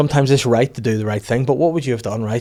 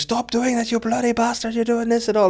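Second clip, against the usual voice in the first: high, strained mock protest; panicked van man; 12 semitones higher than usual. Chip van man tells off the shaker, high protest. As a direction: imitate an outraged man, voice right up high.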